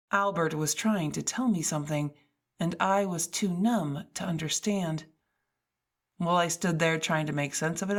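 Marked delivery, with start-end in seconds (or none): none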